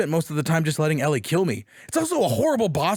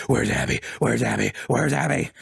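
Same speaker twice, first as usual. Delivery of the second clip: grim demand; 6 semitones lower than usual. Retold or imitated. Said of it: "she's like".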